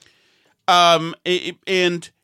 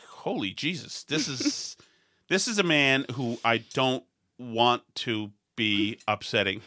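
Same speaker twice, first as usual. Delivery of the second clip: low, soft and wary; hushed awe; 9 semitones lower, 7 dB quieter than usual. Retold or imitated. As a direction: drop to a slow, careful undertone before a man with presence. Awed at the imposing owner's entrance.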